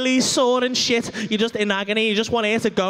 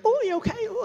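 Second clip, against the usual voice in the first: tender high concern; 9 semitones higher, faster than usual. Retold or imitated. Man gently checks on timid woman.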